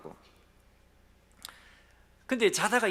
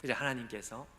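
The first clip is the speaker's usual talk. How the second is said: usual speaking voice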